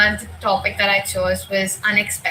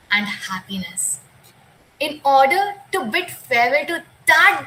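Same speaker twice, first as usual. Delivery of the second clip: poem recitation; 4 semitones higher than usual. Acted.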